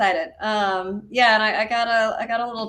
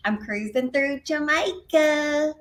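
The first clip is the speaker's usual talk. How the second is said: put-on slow, stretched country accent, higher pitched; 5 semitones higher, slower than usual. Repeating the wife's line in a mock country accent. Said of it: "her countryest country accent".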